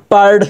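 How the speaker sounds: পাড় with the closing r fully sounded; clear final r. Shown as a demonstration. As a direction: articulate the r of পাড় distinctly. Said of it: "রের উচ্চারণটা একদম স্পষ্ট হবে". (পাড়, র একদম স্পষ্ট)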